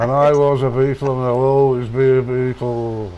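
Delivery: slow Liverpool drawl; lazy Scouse drawl, dragged out. Retold or imitated. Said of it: "talk real slow like they do in Liverpool".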